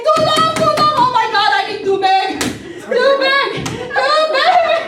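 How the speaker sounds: high, loud panicked screams of too long and too big; mock screaming. Scared of the ghost, screaming mixed-up Tagalog words at neighbors, high panicked wail. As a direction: shriek it like a spooked woman begging for water.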